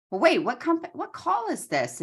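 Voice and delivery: high, puzzled mock voice of the caller; confused caller. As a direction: ask it high and baffled. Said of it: "she was like".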